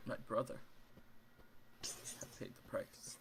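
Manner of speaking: halting, fading to a near whisper; long pauses between the losses, voice sinking away; grieving lord. Acted. Grieving lord counts his losses.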